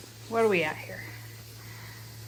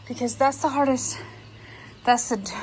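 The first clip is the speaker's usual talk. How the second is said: out of breath. Real, not acted. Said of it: "I am out of breath".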